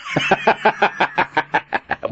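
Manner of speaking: booming villain belly laugh; evil belly laugh. A narrator's reading. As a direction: laugh from the gut, menacing. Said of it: "Marlin shook with a belly laugh".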